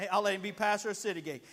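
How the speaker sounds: ordinary talk, nothing marked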